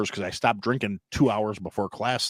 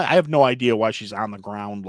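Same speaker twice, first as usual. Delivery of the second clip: ordinary talk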